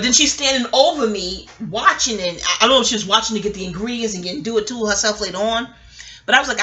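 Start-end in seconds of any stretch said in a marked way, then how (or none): none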